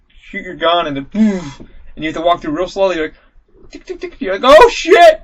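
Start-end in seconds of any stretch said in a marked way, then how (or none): 3.7-4.2 high, very soft little ticks; imitated tiptoe ticking. Player creeping past exploding mummies, high soft imitated ticks. 4.6-5.2 sudden high, loud exclamation; mock startled yell. Player startled by the exploding mummy, mock high yell.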